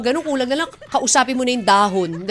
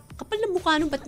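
higher, softer grumble of annoyance; inis na inis; 7 semitones higher, 6 dB quieter than usual. Acted. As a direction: act out a quiet grudge, pitched up. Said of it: "parang inis na inis ka". (inis na bulong-reklamo, mataas ang tono)

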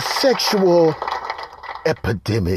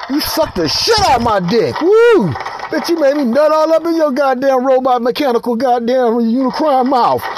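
raised, breathless thrill; excited hype; 9 semitones higher than usual. Acted. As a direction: whoop it up, thrilled with the robot.

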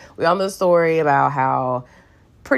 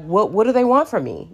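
frustrated God; 6 semitones higher, faster than usual. Retold or imitated. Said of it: "God is, like, frustrated".

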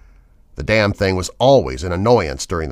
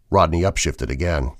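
plain reading voice

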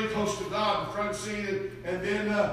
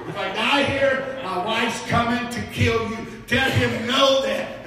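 mock threat; 3 semitones higher, 7 dB louder, slower than usual. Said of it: "Well, I'm serious".

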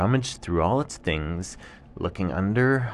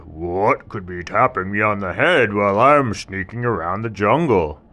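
loud, questioning tiger voice; puzzled tiger; 7 dB louder than usual. Acted. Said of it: "And the tiger said".

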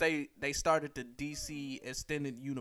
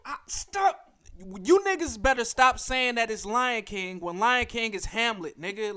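exasperated rant; 5 semitones higher, 6 dB louder, slower than usual. Real, not acted. Exasperated rant about Lion King.

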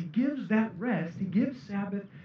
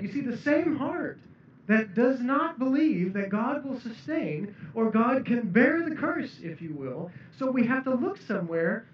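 ordinary talk

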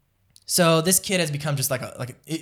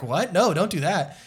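retold startled objection, higher pitched; alarmed friend; 5 semitones higher, 3 dB louder than usual. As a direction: play the friend's mild alarm. Friend objects to killing offer.

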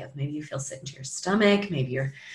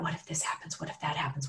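anxious whisper; 3 semitones lower, 10 dB quieter, faster than usual. Retold or imitated. Voicing fear's worried thoughts.

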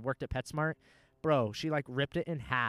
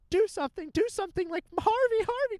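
shrieking very high and loud, in a retold panic; panicked screaming; 10 dB louder than usual. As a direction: imitate a frantic owner screaming for her dog. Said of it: "just screaming".

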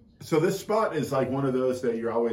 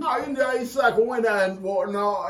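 much higher posh-mock British voice; sustained put-on British accent; 8 semitones higher, 3 dB louder than usual. Shown as a demonstration. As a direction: ham up a British gent. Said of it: "in your British accent".

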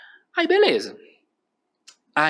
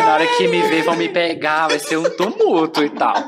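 laughing; 5 dB louder than usual. Real, not acted. Laughing about expected fan mob.

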